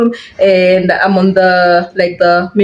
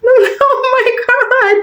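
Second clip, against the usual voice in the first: high and slow, laughing in disbelief; stunned oh my God; much slower than usual. Real, not acted. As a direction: let the laugh lift into a stunned, drawn-out gasp. Reaction to marriage proposal.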